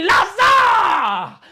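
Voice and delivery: commanding call. Retold or imitated. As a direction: summon him from the tomb. Jesus commanding Lazarus to rise.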